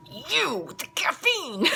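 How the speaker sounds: imitated kid in a thick, high, drawn-out Italian accent; slow, high mock-Italian outburst. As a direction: accuse in a hammed-up Italian accent. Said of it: "In the thickest Italian accent".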